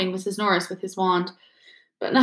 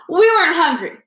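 much louder and higher, too eager; loudly; 9 semitones higher, 6 dB louder than usual. Acted. Ron denying to Snape, loud and high. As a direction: blurt it out loud over the doubt. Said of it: "said Ron loudly".

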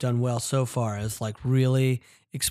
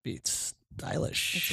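whisper; 2 semitones higher, 10 dB quieter, much slower than usual. Real, not acted. Murmured aside about the cover.

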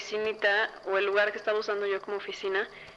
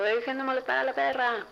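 higher-pitched mock scolding at ghosts; mock yell; 6 semitones higher than usual. Telling off ghosts over the barking dog, mock yell. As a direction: imitate yourself calling out, pitched up without real volume. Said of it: "grito a fantasmas que según yo están".

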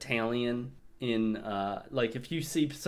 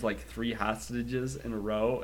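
ordinary delivery

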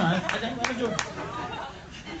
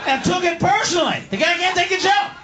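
mock outrage; 8 semitones higher than usual. Mock outrage at Selleck's reaction.

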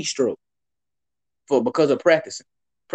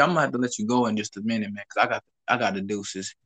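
usual speaking voice

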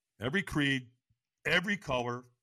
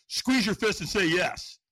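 loud, slow, high command; trainer's scream; 7 semitones higher, 7 dB louder, slower than usual. Retold or imitated. Trainer pushing him at the firelane, yelled command. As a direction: imitate a trainer yelling an order, drawn out. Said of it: "he screamed at me".

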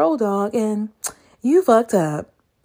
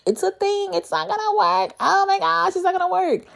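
sassy dismissal; 11 semitones higher than usual. Real, not acted. Mocking mismatched versions of humble.

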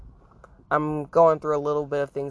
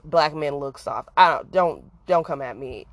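ordinary talk

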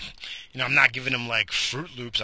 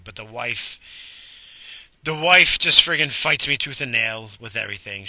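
ordinary talk, nothing marked

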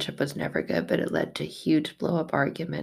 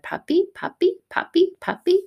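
put-on much higher, excited little-girl squeal; toddler voice; 4 dB louder than usual. Mother imitating her toddler daughter seeing a puppy, high and excited. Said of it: "holding the leash and said".

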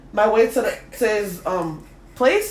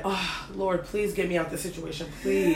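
soft, lowered mock plea; hushed prayer; 4 semitones lower, 8 dB quieter than usual. Retelling her silent prayer to escape the date, hushed. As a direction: pray under your voice for a way out.

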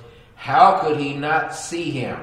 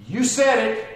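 Jesus quote; 8 semitones higher, 4 dB louder than usual. Acted. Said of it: "And Jesus answered him".

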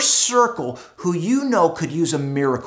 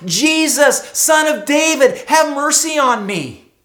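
pleading call, higher and louder; crying out; 7 semitones higher, 5 dB louder than usual. Acted. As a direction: cry out for mercy, building. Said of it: "he begins to cry out".

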